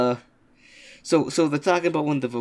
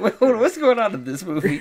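higher, louder baffled exclaim; confused shock; 7 semitones higher, 5 dB louder than usual. Retold or imitated. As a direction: blurt it out bewildered. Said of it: "I was like".

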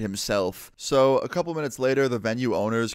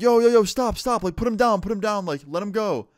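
high-pitched acted pleading yells; urgent yelling; 10 semitones higher, 5 dB louder than usual. Band yelling at the man dangling their friend, acted urgent yelling. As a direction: call out as if to stop a disaster, easing slightly in volume. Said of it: "everybody starts yelling out".

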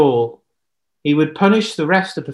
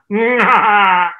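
loud, high villainous cackle; evil laugh; 5 semitones higher, 7 dB louder than usual. Acted. Jezebel gloating over her plot, evil cackle.

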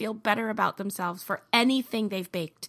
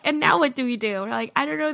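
excited exclaim; 3 semitones higher, 6 dB louder than usual. Retold or imitated. Excited retelling, laughing.

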